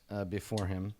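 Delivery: normal talking voice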